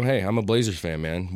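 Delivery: ordinary talk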